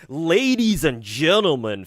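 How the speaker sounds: mock grand opening line; bombastic announcer. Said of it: "like, bombastic".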